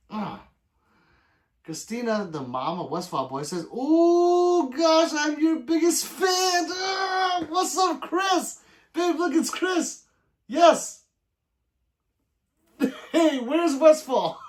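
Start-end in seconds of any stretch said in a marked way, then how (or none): 3.7-6.7 high, loud squealing fan voice; mock fangirl squeal. Reading the mom's chat as a squealing fan. Mom of Westfall Boy quoted. 6.7-14.4 high, loud squealing fan voice; mock fangirl squeal. Reading the mom's chat as a squealing fan.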